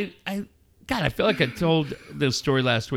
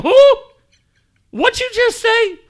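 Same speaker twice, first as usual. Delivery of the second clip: loud, very high incredulous question; comic high-pitched challenge; mock shock; 9 dB louder than usual. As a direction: squawk it in disbelief. Retold exchange after son swears.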